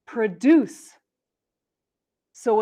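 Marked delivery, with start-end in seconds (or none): 0.1-0.9 produce as pruh-DUCE; second-syllable stress. Shown as a demonstration. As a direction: keep produce's first syllable short, punch DUCE. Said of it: "verbs often stress the second syllable".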